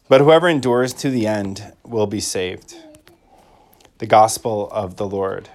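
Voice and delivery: solemn liturgical reading; gospel proclamation. Acted. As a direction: proclaim it from the ambo.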